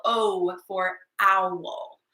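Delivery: slow, careful teaching voice; slow clear O for owl. Shown as a demonstration. Teacher to kids, slowly saying O for owl. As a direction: spell it out slowly.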